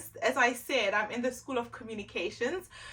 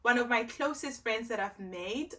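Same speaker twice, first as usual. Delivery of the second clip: ordinary delivery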